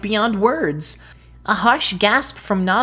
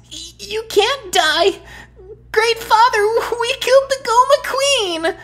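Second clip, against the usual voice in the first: much higher and louder, desperate; tearful cry; 5 dB louder than usual. Acted. Boy cries out that the Great Father cannot die. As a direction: plead through tears.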